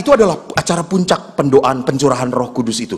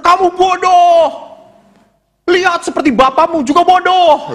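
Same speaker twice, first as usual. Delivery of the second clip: mock scolding; 9 semitones higher than usual. Parent berating a child as stupid, mock scolding. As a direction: scold in an exaggerated high voice.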